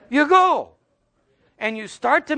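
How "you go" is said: sudden high firm command; mock command. Preacher telling parents to make teens go. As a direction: bark it like a parent ending the debate. Preacher urges attending church.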